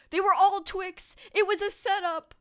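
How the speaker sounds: high, loud indignant yell; half-hearted George yelling; George yelling impression. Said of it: "I can't do a good George yelling impersonation".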